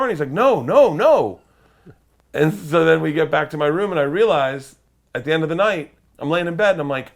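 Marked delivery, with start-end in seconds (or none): 0.3-1.4 higher, louder run of insistent no's; emphatic refusal. Retold or imitated. Bodyguard turning down a cigar, emphatic refusal. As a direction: refuse firmly, pitch swinging up.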